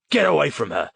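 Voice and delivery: high, loud command; order. A narrator's reading. Policeman orders the boy away from the girl, loud command. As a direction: bark the order. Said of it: "the man ordered".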